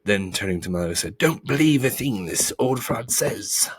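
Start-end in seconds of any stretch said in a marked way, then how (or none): 1.2-3.7 indignant, high-pitched bee; angry warning. A narrator's reading. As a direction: warn the boy off the fraud, crossly. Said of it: "accused the bee angrily".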